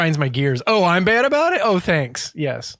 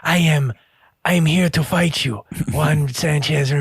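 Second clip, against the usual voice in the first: Christopher Lambert impression. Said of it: "He talks like this all the time".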